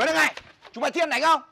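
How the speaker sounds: high, loud scolding; angry shout. Acted. Telling off friends who tease, angry high shout. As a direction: yell at them to cut it out. Snaps at the teasing chanters.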